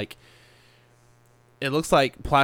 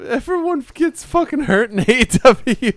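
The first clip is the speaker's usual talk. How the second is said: loud, very high-pitched wail; high, loud comic exclamation; mock outcry; 12 semitones higher, 8 dB louder than usual. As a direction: blurt it shrilly, far above your usual voice. Joking jab about wrestling injuries.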